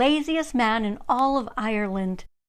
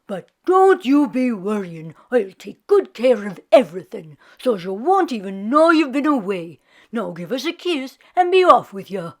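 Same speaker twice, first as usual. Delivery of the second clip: lazy Irishman; 5 dB louder than usual. Acted. Jamie reassuring his wife.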